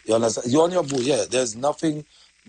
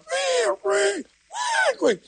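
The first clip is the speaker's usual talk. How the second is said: shrill-high calling out, much higher; yelling up high for someone to come quick; high mock shout; much slower than usual. Host mimicking a caller at the studio door, high mock shout.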